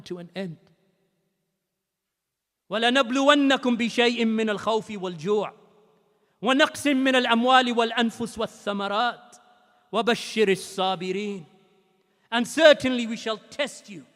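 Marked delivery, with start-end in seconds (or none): 2.7-11.5 rapid, flowing Arabic recitation; Quran recitation. Acted. Preacher reciting the verse to his congregation, chanted recitation. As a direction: recite the verse in one rising breath.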